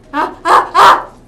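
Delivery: high, loud panicked cries; panicked yelps. Real, not acted. Gamer panicking in a fight, loud rising yelps. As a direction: yelp in panic.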